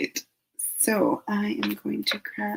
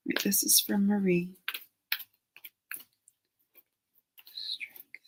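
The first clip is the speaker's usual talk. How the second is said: whisper; 2 semitones higher, 7 dB quieter than usual. Real, not acted. Drawing cards, whispering to herself.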